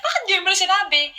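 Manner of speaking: high-pitched whiny complaint; sister complaining. Retold or imitated. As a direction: whine it like the upset older sister. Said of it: "Tapos sabi niya". (ate na nagrereklamo, mataas ang boses)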